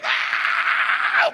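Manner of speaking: high-pitched drawn-out effort scream; straining yell. Acted. Lifter forcing up the tenth rep, straining scream. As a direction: squeal with the strain of the final push. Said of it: "And then you're like".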